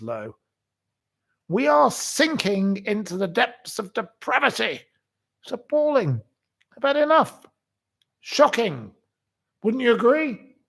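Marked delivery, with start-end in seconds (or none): 1.5-10.6 high, indignant raised voice; carried-away outraged shout; shouting rant. Real, not acted. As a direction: rail at the nation's decline. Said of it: "I don't mean to shout".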